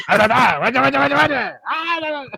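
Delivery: loud, high-pitched babbling; frantic mock gibberish. Fan imitating the bug fighting with an antenna, frantic gibberish. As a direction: babble wildly on a rising pitch.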